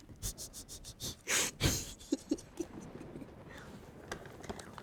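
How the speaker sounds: chuckles breaking into high giggles; real laugh. Real, not acted. Streamer cracking up at own six seven joke, giggling. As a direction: crack up after holding it in.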